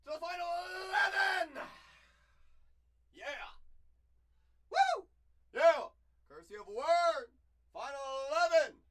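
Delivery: loud, high celebration yell; excited shout. Real, not acted. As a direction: cheer like a win. Celebrating the reveal.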